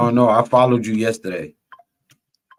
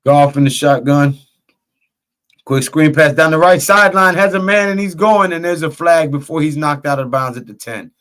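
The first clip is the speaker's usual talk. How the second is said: loud, higher, excited football call; live play-by-play; 5 semitones higher, 7 dB louder than usual. Real, not acted. Announcer calls the screen pass for listeners, live play-by-play. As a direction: call the play as it breaks open.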